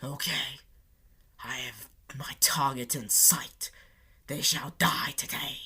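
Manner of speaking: creepy villain whisper, low and breathy; sinister whisper. Retold or imitated. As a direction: hiss it like a stalker with a target. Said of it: "peering out their window like".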